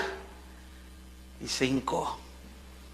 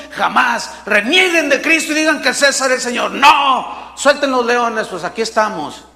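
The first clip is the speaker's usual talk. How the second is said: higher, louder defiant declaration; defiant martyrs; 4 semitones higher, 3 dB louder than usual. Acted. Martyrs facing the lions, defiant rising shout. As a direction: proclaim it boldly, rising to a shout. Martyrs defying Caesar in Coliseum.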